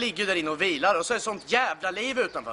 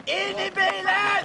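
shout; 10 semitones higher, slower than usual. Acted. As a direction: bark them into the car. Ordering people into car.

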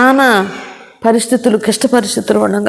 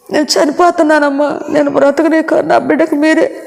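tearful plea; 7 semitones higher than usual. Retold or imitated. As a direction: plead as if these are your last words. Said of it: "అందరు కలిసి గళ్ళుమని ఏడ్చారు".

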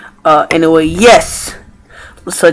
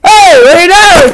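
ordinary talk, nothing marked

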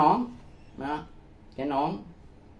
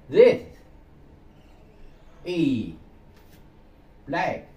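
slow halting drill; 3 semitones higher, 3 dB quieter, much slower than usual. Shown as a demonstration.

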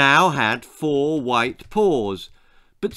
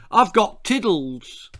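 declaring; 6 semitones higher than usual. Acted. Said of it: "she declared".

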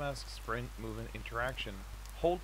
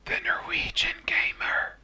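whispered tagline; 7 dB louder, slower than usual. Acted. Channel intro tagline.

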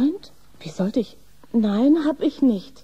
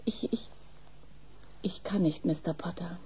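soft, nervous near-whisper; hushed and flustered; 5 semitones lower, 12 dB quieter than usual. Acted. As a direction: barely dare to say it. Shy nurse resisting advances.

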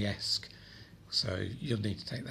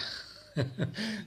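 brief amused laugh; quick rueful chuckle; short laugh; 9 semitones higher than usual. Real, not acted. Laughs at drone catching fire.

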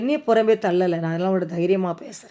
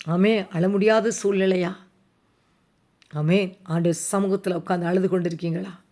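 ordinary talk

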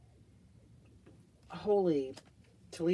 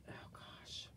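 awed whisper; 16 dB quieter than usual. Real, not acted. Sniffing candle, awed.

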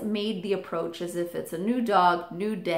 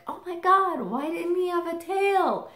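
put-on high, childlike alarmed voice; high kid voice, shocked; worried little kid; 10 semitones higher than usual. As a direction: play your four-year-old self, upset. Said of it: "I'm like".

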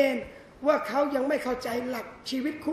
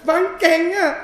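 higher, louder delighted praise; gushing wife; 4 semitones higher, 6 dB louder than usual. Acted. Wife thrilled husband fixed her phone, gushing. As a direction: fawn over him, beaming. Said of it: "แกล้งโง่บ้าง".

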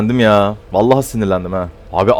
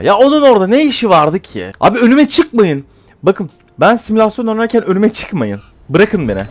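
exasperated shout; 9 semitones higher than usual. Real, not acted. Scolding teammates in the flight sim, exasperated shout. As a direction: snap at teammates to stay out of the way. Angry at teammate who shot.

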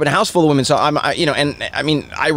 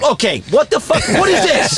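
shocked dad; 10 semitones higher than usual. Retold or imitated. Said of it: "My dad goes".

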